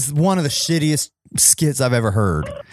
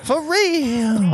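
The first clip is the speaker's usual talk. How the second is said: mimicking the woman's voice; 8 semitones higher than usual. Echoing the skit voice.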